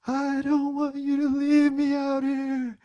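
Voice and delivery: high, loud mock wail; hammed-up toddler crying through the words; bawling little kid. Son begging dad not to leave, imitated bawling. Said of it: "that was the kind of crying".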